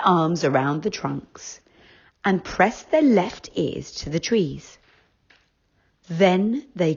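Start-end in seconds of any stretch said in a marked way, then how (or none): none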